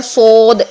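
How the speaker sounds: سود with a long round o; wrong rounded saad. Shown as a demonstration. Teacher showing students a mistake, سود with o for aw. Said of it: "یہ غلط ہے".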